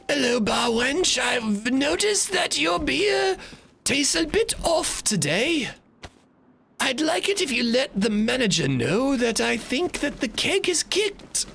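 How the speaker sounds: very high, slow mock English lady; high-pitched English Karen accent, politely complaining; put-on English accent. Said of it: "that was a pretty good accent I just did".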